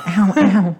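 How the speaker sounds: high, loud mock yelp; imitated ow. Host replaying the co-host's yelp, high mock cry. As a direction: mimic someone's cry of pain, high. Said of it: "and then you went".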